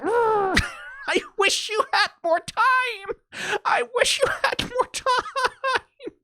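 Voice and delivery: high, slow, mock crying wail; hammed-up sobbing lament. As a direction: ham up the tearful plea. Mocking a tearful show line.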